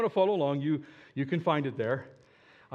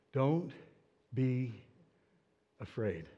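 soft, lower, unhurried comfort; calm reassurance; 4 semitones lower, 6 dB quieter, much slower than usual. Acted. Elisha calming his frightened servant, softly reassuring. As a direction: settle his fear gently.

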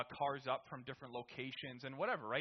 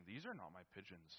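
low, much softer flat statement; quietly notice the wrong birds; low grim realization; 7 semitones lower, 11 dB quieter than usual. Retold or imitated. Dad stepping into the coop, low ominous realization. Dad finds fake pigeons.

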